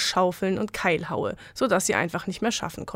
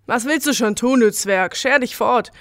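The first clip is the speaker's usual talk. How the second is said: shouting back; 3 semitones higher, 6 dB louder than usual. Acted. Mine owner yells back at the spirit, defiant shout. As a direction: shout the dwarf away. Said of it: "schrie Wagner zurück".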